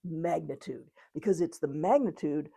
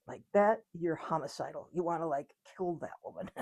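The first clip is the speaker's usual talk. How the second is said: ordinary talk, nothing marked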